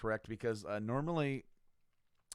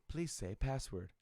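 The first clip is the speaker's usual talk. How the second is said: computer voice; 3 semitones higher, slower than usual. Retold or imitated. Lab computer voice.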